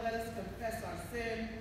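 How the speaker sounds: ordinary talk, nothing marked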